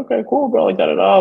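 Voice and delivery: very high, airy okay cool bro; carefree, sing-song dismissal; breezy brush-off. Retold or imitated. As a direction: wave him off lightly, voice way up. Brushing off guy who dapped him.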